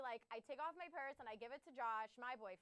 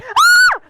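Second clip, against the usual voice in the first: high, loud fangirl shriek; loud delighted scream; excited scream; 38 dB louder than usual. Retold or imitated. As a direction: shriek like a fan who hears her story. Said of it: "and then I went".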